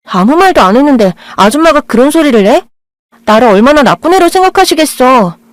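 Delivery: indignant outburst. Acted. Blind girl snapping at the boy about his mother. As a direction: flare up, feeling wronged.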